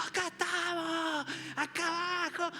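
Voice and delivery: high, stretched-out call; calling from below. Acted. Disciples to those up the mountain, high drawn-out calling. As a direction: call up to someone far above. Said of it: "desde abajo".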